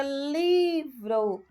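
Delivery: livro with a long, held LI; stress on LI. Shown as a demonstration. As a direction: stretch the first syllable LI in livro. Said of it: "o li é a sílaba tônica". (livro com o LI bem alongado)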